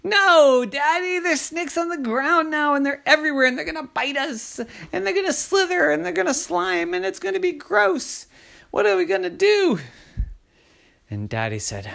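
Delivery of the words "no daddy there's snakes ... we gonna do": very high, fast, breathless-run-on panic; panicked child. Acted. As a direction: rattle off every horror at top pitch. Panicking kids reject daddy's plan.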